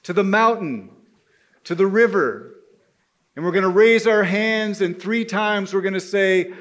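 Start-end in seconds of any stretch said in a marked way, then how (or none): none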